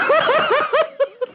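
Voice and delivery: real laugh, high and bright; high burst of laughter. Real, not acted. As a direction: crack up at your own joke. Laughs at own joke.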